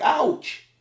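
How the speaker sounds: sudden high-pitched mock yelp of pain; pained ouch. Child with flashlight in his face, pained yelp. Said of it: "the light was so bright".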